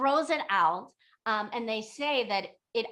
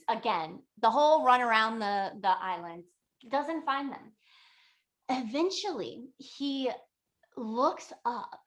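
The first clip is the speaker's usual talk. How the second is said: ordinary delivery, nothing marked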